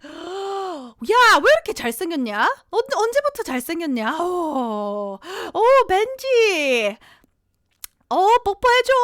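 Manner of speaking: high, loud, hammed-up cooing at a dog; doting Korean dad. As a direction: imitate a smitten dad gushing in Korean. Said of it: "I'm gonna speak in Korean".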